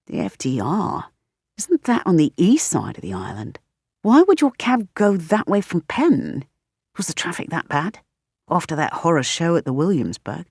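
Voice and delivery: put-on chatty questions in a light British accent; a hint of a puzzled Brit; curious British roommate quizzing; lightly British roommate.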